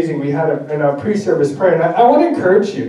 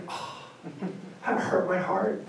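hurt wife; 16 dB quieter than usual. Retold or imitated. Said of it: "I'd be like".

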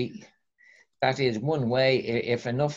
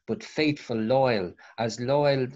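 ordinary talk, nothing marked

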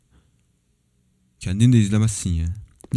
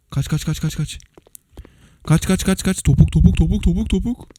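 climbing high frantic run chant; rising panicked getaway shouts; frantic fleeing; 7 semitones higher than usual. Real, not acted. Fleeing boss after the prank.

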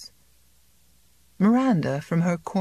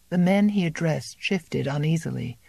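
plain reading voice

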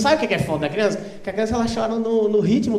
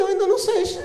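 high, softer European Portuguese impression; imitated Portuguese local, pitched up; mock European Portuguese; 9 semitones higher, 5 dB quieter than usual. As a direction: ask in a put-on Portugal accent. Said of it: "só entende se falar assim".

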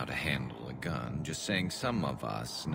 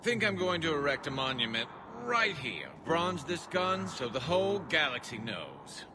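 much higher, proud rooftop proclamation; triumphant call. Acted. Garrus proclaiming his win over the wind, high triumphant call. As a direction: crow to the whole galaxy.

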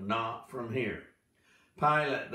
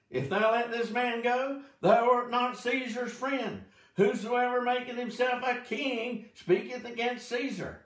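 much higher, pressing voice; crowd crying out; 11 semitones higher than usual. Acted. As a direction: cry it high like an insistent mob.

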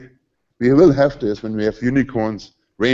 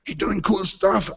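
softer, slowed, gushing youngsters; mock-impressed young team; 5 semitones higher, 6 dB quieter, slower than usual. Young team marvels at a fifty-two-year-old, softly gushing. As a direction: gush over the older guy like a novelty. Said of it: "they were like".

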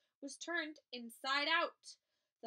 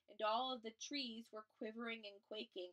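ordinary delivery, nothing marked

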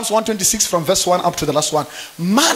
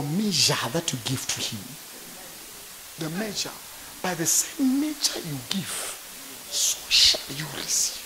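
whispered scripture, lower; hushed; 5 semitones lower, 10 dB quieter than usual. Acted. Pastor whispering scripture to the church. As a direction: breathe the verse almost silently.

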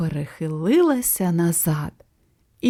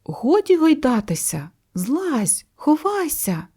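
urgent warning cry; 7 semitones higher than usual. A narrator's reading. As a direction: urge her down in a raised pitch. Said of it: "крикнув ворон".

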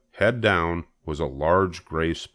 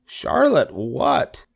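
high, drawn-out pig question; eager question; 6 semitones higher, much slower than usual. A narrator's reading. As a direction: ask eagerly, stretching it out. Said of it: "asked Wilbur eagerly".

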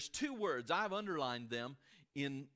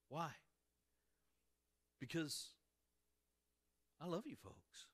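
sincere confession; 6 dB quieter than usual. Real, not acted. Pastor confiding his burden.

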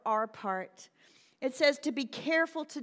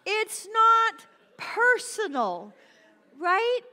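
"not" and "personal" each punched loud and high; "not personal" stretched out, high and forceful; slow emphasis; 9 semitones higher, 8 dB louder, much slower than usual. Real, not acted. Preacher hammering home the point to listeners, slowly emphatic.

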